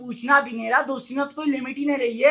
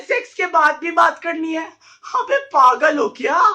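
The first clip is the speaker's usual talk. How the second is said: high, loud outburst of disbelief; exasperated shout; 6 semitones higher, 7 dB louder than usual. Acted. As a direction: yell it as if they've gone mad. Ranting in disbelief at the demand. (झुंझलाकर ऊँची आवाज़ में चिल्लाना)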